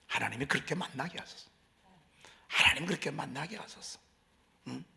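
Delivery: ordinary talk, nothing marked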